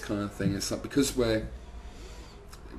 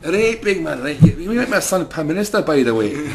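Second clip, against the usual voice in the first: higher, loud wheedling plea; bargaining with the devil; 7 semitones higher, 11 dB louder than usual. Pretend. Blair's dad at the crossroads pleading with the devil, loud and high. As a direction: call out to the devil, cheeky.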